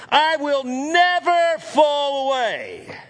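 high, drawn-out, defiant declaration; emphatic vow. Acted. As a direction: stretch it out as a ringing, stubborn pledge. Peter's emphatic vow acted out.

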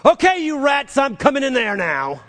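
high, loud call to scare the rats; aunt calling out at rats to feel brave; mock brave shout. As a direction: shout to work up courage. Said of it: "I gotta go say".

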